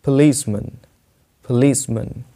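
schwa in policeman's ending; long ee, short mun in policeman; policeman as "puh-LEES-mun". Shown as a demonstration. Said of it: "कैसे बोलना है आपको".

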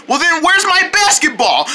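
high-pitched exasperated demand; frustrated outburst. Acted. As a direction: demand it back, voice rising high. Frustrated search for lost ball.

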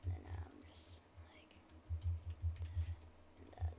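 breathy whisper to himself. Real, not acted. Muttering in a whisper while building his house. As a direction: whisper it under your breath. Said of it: "extremely quiet right now".

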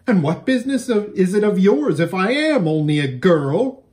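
high, loud indignant snap; snapping. Acted. Girl snapping at Shasta, high and loud. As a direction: bite back, insulted. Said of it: "snapped the stranger".

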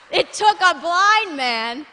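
very loud, high, drawn-out shout; full-force preaching. Real, not acted. Preacher driving the point home to church, preaching at full force. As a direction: belt it out slowly to the whole room. Preacher hammering a repeated line.

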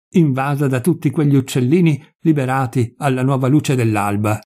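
ordinary delivery

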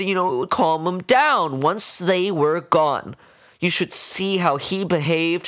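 high woman's voice, only a hint of crying; tearful. A narrator's reading. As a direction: plead through mild tears. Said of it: "She was crying now".

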